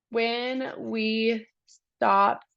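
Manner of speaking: drawn out, word by word; slow dictation. Real, not acted. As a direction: say it slowly as you write. Dictating while writing slowly.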